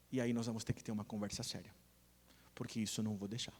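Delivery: low, soft, measured fatherly reprimand; quiet stern warning. Retold or imitated. Father defends his wife to their child, quiet stern warning.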